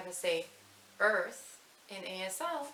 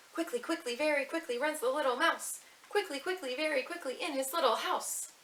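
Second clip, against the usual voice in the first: quick and lively; 8 semitones higher than usual. Shown as a demonstration. Teacher reciting to little ones, quick and high. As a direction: rattle it off high and bright. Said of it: "say the quickly mouse part quickly".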